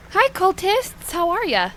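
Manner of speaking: high, drawn-out hello; chirpy mock greeting. Player greeting the yellow cultists, chirpy mock greeting. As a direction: greet them in a high, slow singsong.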